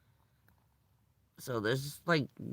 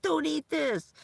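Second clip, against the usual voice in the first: much higher, frantic warning; panicked doctor; 11 semitones higher than usual. Retold or imitated. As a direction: blurt it like an alarmed doctor. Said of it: "But he was so panicked".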